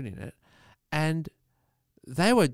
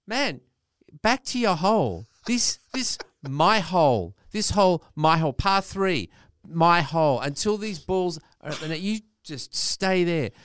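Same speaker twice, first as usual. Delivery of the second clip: higher, louder, mock-exasperated outburst; indignant telling-off; 5 semitones higher, 5 dB louder than usual. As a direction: tell off an encroaching kid in short bursts. Telling off the kid behind him.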